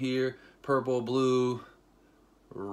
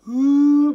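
loud, high drawn-out hoot; exaggerated overwhelmed ooh; high "ooh" howl; 14 dB louder than usual. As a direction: let out a big emotional whoop. Reacts to tearjerker scene.

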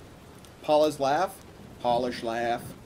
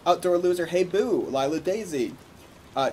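ordinary delivery

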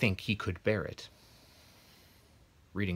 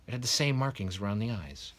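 ordinary delivery, nothing marked

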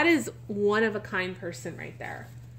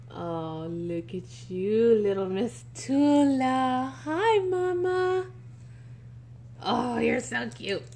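doting coo. Real, not acted. Cooing to the doll again.

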